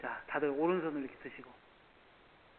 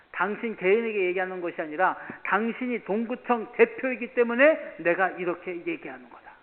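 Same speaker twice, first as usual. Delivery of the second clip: sternly scolding; 3 semitones higher, 8 dB louder than usual. Retold or imitated. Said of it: "따끔하게 얘기를 했어요".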